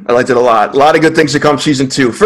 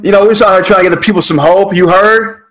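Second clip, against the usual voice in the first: hype; 6 semitones higher than usual. Acted. Hammed-up show opener.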